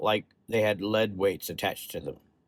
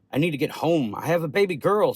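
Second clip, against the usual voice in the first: higher, pleading insistence; distressed protest; 6 semitones higher, 4 dB louder than usual. A narrator's reading. Patient to doctor, needing to get home, pleading. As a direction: plead against the news, pitch climbing.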